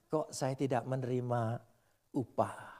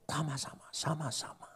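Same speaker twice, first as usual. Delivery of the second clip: hushed; 7 dB quieter than usual. Acted. Preacher drops to a hushed echo.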